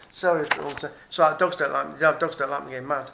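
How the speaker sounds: ordinary delivery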